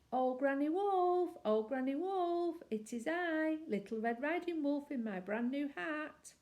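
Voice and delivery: little wolf calling to granny at her door. A narrator's reading. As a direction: call out sweetly at the door.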